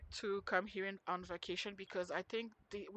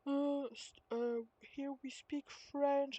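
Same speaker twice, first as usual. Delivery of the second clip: higher, drawn-out mock lady; snooty lady imitation; 6 semitones higher, slower than usual. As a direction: imitate her correcting a tourist, high and slow. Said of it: "this lady who was like".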